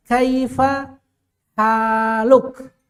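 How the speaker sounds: "Kaifa haluk?" with the long vowels clearly stretched; long vowel demo. Shown as a demonstration. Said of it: "ada yang panjang".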